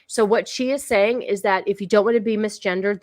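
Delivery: ordinary talk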